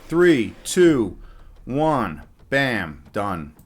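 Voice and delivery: usual speaking voice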